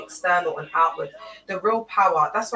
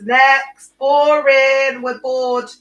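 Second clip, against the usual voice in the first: mock bored outburst; 6 semitones higher, 10 dB louder, much slower than usual. Streamer mocking dull characters, loud scornful emphasis. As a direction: punch each word loud and high in disgust.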